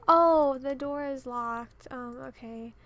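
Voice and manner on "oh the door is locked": loud and slow, fed up; loud exasperation. Real, not acted. Gamer finding the Sim's door locked, loud exasperated. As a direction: blurt it out, exasperated.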